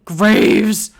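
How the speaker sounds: exasperated yell; loud shout. Acted. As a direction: shout the name out after it. Shouting after the cat.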